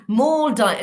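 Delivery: Maldives, long O held; long vowel demo. Shown as a demonstration. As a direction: stretch the O in Maldives. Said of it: "esa es una O larga".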